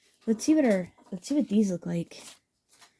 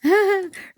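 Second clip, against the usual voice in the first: high, bright burst of laughter; delighted laugh; 12 semitones higher, 4 dB louder than usual. Real, not acted. Delighted at the craft result.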